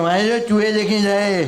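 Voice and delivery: slow, loud, high menacing growl of a tough guy; hammed-up thug threat; angry threat. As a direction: play the scary rickshaw driver. Impersonating angry Aba Kadar.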